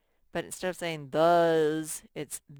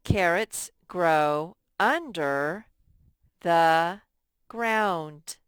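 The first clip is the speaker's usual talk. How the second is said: slow and louder, leaning on "under"; slow emphatic reading; 8 dB louder, much slower than usual. Shown as a demonstration. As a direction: read it aloud slowly for the class. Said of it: "teacher reads model sentence".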